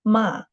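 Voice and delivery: "ma" falling sharply from high; fourth tone. Shown as a demonstration. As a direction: drop "ma" firmly from top to bottom. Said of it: "fourth tone".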